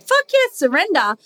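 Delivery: high, bright, eager yes to surrender; the mind cheerfully talking itself into it; eager inner voice. Acted. The mind insisting it feels safe with partner, high and eager. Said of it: "And I can say, like".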